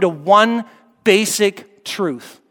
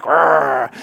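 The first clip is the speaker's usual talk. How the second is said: low rolling "rrr" of an engine; engine roar; 4 semitones lower than usual. Retold or imitated. Driver imagining hitting the bump, growling engine noise. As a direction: imitate a motor revving up. Imitates revving over the bump.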